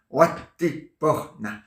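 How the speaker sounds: Watiporna stretched out very slowly; very slow nonsense incantation. Shown as a demonstration. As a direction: drag out each syllable of Watiporna. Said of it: "je dis n'importe quoi à l'envers".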